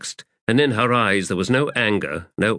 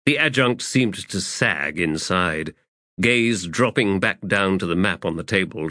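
ordinary delivery, nothing marked

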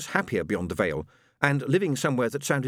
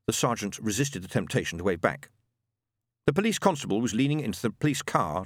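usual speaking voice

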